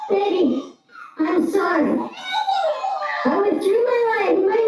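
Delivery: father shaken after dog attack, voice cracking with tears. Real, not acted. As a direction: shaken and tearful, calling out. Distraught after attack, crying.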